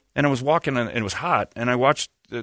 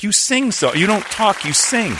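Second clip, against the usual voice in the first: excited; 11 semitones higher, 3 dB louder, slower than usual. Retold or imitated. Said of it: "I'm like".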